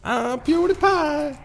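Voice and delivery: very high, drawn-out mock Swedish; [name], mock Swedish accent. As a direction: squeal it slowly in joke Swedish. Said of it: "I can't even do a Swedish one".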